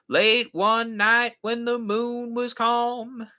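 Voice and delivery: high, slow twangy singer impression; Jack and Jim voice. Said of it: "get my best, um, Jack and Jim voice".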